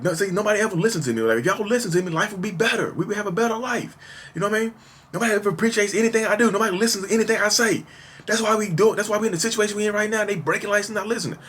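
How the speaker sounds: high-pitched, rushed mock yelling; imitated ranting. Retelling his rage at the family, imitated yelling. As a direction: rant in a high, strained voice without real volume. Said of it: "I'm yelling and screaming".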